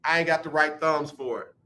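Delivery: ordinary talk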